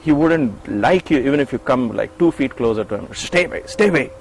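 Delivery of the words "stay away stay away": high, urgent warning off; retold warding-off command. Swami keeping people at a distance, sharp warning. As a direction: imitate someone sharply fending people away.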